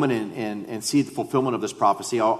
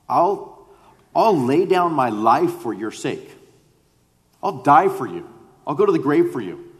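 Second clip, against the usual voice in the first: raised, emphatic pledge of devotion; fervent vow; 4 semitones higher, 5 dB louder than usual. Acted. Peter pledging his life to Jesus, fervent vow. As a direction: insist on it, higher and louder.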